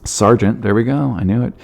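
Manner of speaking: ordinary delivery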